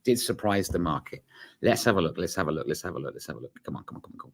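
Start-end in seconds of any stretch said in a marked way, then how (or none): 1.6-3.5 fading to a murmur. Real, not acted. Waiting for data release, repeating. 3.6-4.3 whispering. Real, not acted. Impatient wait for the number.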